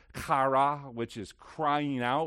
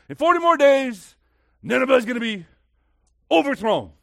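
shouted message; 10 semitones higher, 13 dB louder than usual. Acted. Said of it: "It just says".

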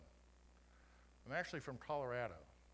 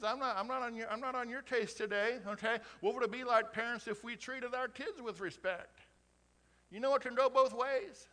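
raised, loud preaching to the congregation; preaching at full force; 8 semitones higher, 7 dB louder than usual. Acted. Pastor urging parents and kids, loud preaching.

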